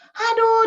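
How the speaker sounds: high, sharp exasperated "aduh"; mother's groan at an empty page. Retold or imitated. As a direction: let out a fed-up cry. Mother exasperated, child wrote nothing.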